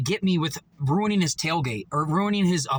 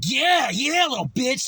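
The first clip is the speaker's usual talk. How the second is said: higher, mocking jeer; taunting jeer; 4 semitones higher, slower than usual. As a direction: mimic the bystander's taunt. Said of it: "just like".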